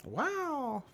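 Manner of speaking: high silly clown exclamation. Pretend. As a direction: squeal it like a clown. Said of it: "Do it as clown voice".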